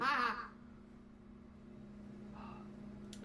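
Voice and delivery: wheezing laughter; high breathy laugh. Real, not acted. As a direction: laugh hard at it. Laughs at own joke.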